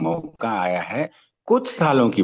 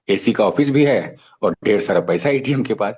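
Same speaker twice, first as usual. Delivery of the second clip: ordinary talk, nothing marked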